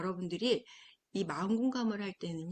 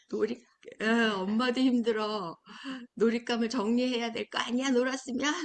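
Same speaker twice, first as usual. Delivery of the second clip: 더 크게 나무라는 엄마 흉내; 지친 엄마가 아이를 꾸짖듯이; 다그치는 엄마; 3 semitones higher, 8 dB louder than usual. Retold or imitated. (놀잇감 정리를 안 하는 아이에게 엄마가 크게 꾸짖듯이)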